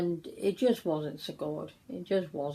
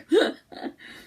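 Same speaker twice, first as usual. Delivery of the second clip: breathy laugh; 10 semitones higher, 4 dB louder than usual. Real, not acted. Laughing at the shop story.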